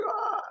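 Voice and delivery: very high-pitched, small "ah"; high little yelp; child's startled cry. Retold or imitated. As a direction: imitate a bewildered little boy's squeal. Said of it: "He's just like".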